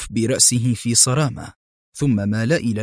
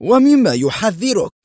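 high, loud urgent question; agitated exclamation; 9 semitones higher, 5 dB louder than usual. A narrator's reading. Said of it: "هتف أليكسي في انفعال". (بانفعال وصوت مرتفع)

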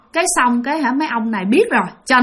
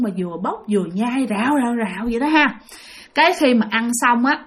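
usual speaking voice

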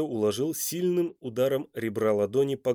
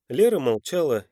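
plain reading voice

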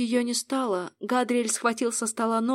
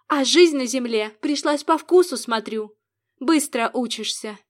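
louder sarcastic sneer; 2 semitones higher, 6 dB louder than usual. Acted. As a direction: sneer at her quick learning. Angel's sarcastic jab.

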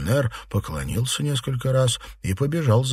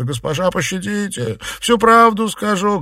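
high, desperate plea; begging; 13 semitones higher, 7 dB louder than usual. A narrator's reading. Servant begging his mistress for mercy, begging. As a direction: implore at high pitch, building to a loud cry. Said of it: "взмолился".

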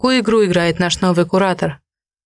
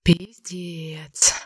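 whispered curse under breath; 7 dB quieter than usual. A narrator's reading. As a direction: breathe the swear out voicelessly. Heroine swears under breath.

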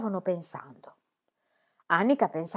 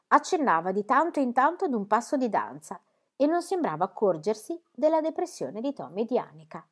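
plain reading voice

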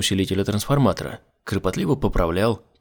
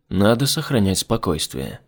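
plain reading voice

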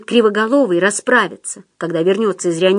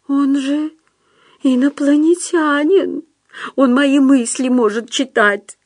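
high, tearful character voice; tearful fear; 6 semitones higher than usual. A narrator's reading. Tearful Худяков fears his mind is read, high anxious voice. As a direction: plead in fear, pitched up at first. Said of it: "Худяков растирал по лицу слёзы".

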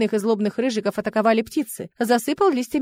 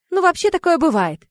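higher, louder airy remark; philosophical; 8 semitones higher, 5 dB louder than usual. A narrator's reading. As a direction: toss it off with philosophical detachment. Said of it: "философски сказала Сая".

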